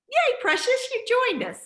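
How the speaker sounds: high-pitched, delighted cheer of greeting; excited welcome. Real, not acted. Host cheers a newcomer joining the call, excited welcome. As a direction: greet them with a real high lift.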